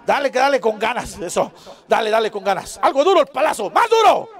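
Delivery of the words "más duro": egging on. Acted. Urging the helper child.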